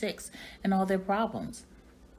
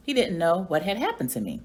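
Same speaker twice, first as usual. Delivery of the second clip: plain reading voice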